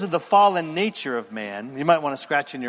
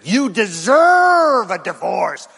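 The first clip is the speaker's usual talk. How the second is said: slow, loud, higher shout; a demon frog yells at a spouse to leave; shouted demon temptation; 4 semitones higher, 7 dB louder, slower than usual. Acted. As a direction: bellow the lie like a demon.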